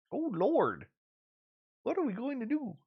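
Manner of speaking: quiet echo of the mock lament. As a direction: murmur it like a worried granny to herself. Repeats Gail's lament softer.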